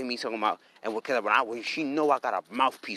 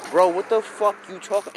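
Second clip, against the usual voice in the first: high, louder incredulous outburst; exasperated disbelief; 7 semitones higher, 5 dB louder than usual. Retold or imitated. Man retelling his reply to a pointless long text, incredulous. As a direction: throw it at them in exasperated disbelief.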